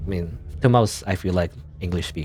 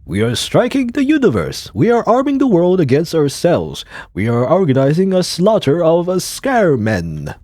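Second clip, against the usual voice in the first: loud, high stage oration; hammed-up war speech; dramatic actor; 7 semitones higher, 8 dB louder than usual. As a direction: proclaim it to the whole hall. Reads a dramatic actor's line.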